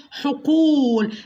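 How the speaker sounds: very slow "حقول", oo held long; stretched waw. Shown as a demonstration. Teacher shows the lengthened letter, slow drawn-out "حقول". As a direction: hold the oo of "حقول" right out. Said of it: "مديت الحرف دوت اللي هو الواو".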